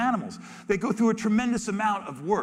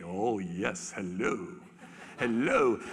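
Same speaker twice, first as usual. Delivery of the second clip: much lower, soft besotted hello; smitten male tiger; 9 semitones lower, 5 dB quieter, much slower than usual. Retold or imitated. Male tiger following the female, low and smitten. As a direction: drool over her. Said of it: "he follows immediately".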